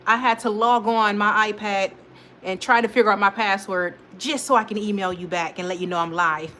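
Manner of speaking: ordinary talk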